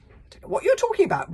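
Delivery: loud, fast, high bellow; roar. Acted. Chief roaring denial at the librarian, loud and fast. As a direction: roar it back in his face. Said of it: "roared Stoic the Vast".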